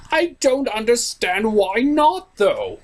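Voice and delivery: high, slow put-on whine; whiny Discord voice. Discord whines to the reviewer about being left out. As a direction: complain like a spoiled trickster.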